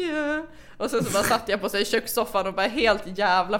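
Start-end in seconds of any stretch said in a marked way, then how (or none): 0.0-0.5 high, small mock-pitiful yes; retold pitiful yes. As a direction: answer like a fragile child. Retold fragile reply to grandmother. (ynkligt, högt ja)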